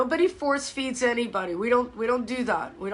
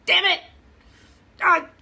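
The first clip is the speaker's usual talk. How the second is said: louder, higher mock curse at the bills; a retold burst of annoyance; imitating a younger self snapping at a bill; frustrated outburst; 6 semitones higher, 6 dB louder than usual. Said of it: "I was like".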